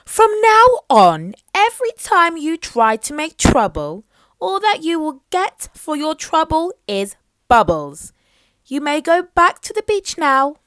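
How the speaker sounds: loud, high royal reprimand; stern queen. Acted. Mermaid Queen ordering Little Miss Trouble to behave: stern, loud scolding. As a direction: lay down the law to a naughty girl.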